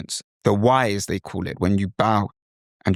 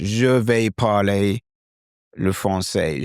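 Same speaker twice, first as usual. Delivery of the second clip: slow British-accented French; English-accented French; slower than usual. Shown as a demonstration. Teacher announcing he will speak French, English-accented French. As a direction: plod through it with English vowels. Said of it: "So the bonjour is English".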